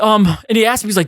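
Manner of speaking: normal talking voice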